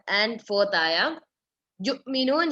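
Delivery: normal talking voice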